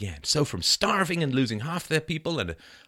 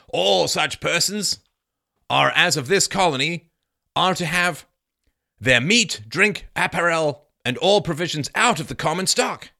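loud, higher-pitched declamation; declaimed historical quote; 6 semitones higher, 6 dB louder than usual. Acted. Reading Bradford's account to listeners, loudly declaimed. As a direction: proclaim it slowly and grandly.

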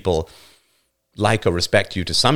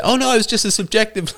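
mock high excuse; 12 semitones higher than usual. Mocking an excuse.

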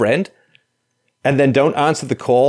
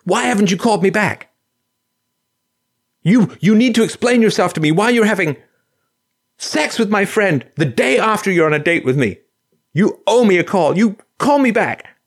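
higher, demanding voicemail voice; angry girlfriend; 7 semitones higher than usual. Retold or imitated. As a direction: press him hard to call back, pitched up.

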